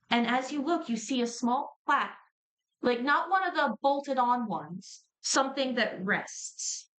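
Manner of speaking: ordinary talk, nothing marked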